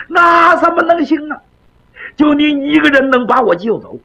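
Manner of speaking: 高声急切地不敢相信; 吓得嚷起来; 惊慌质疑. Acted. 韩宝惊慌难以置信. (惊得提高嗓门质问)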